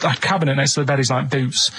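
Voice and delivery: normal talking voice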